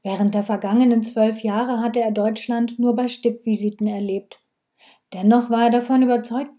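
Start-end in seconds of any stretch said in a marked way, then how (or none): none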